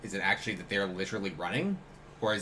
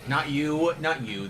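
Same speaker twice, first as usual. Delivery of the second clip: louder, higher reassurance; emphatic; 6 semitones higher, 6 dB louder, slower than usual. Real, not acted. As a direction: insist it's not you. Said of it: "Not, not you".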